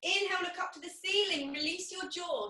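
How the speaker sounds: ordinary talk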